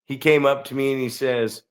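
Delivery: ordinary talk, nothing marked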